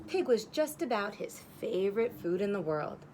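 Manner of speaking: ordinary talk, nothing marked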